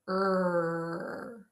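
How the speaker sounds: held "Er" with rounded lips; R sound. Shown as a demonstration. Teacher shows class how lips should be, held R sound. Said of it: "when you make the R sound".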